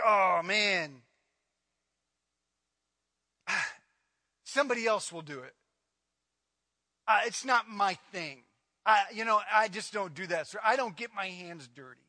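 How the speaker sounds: low, sighing mock excuses; reluctant shrug-off. Preacher mimicking people who won't help, reluctant and low. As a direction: act out someone wearily begging off.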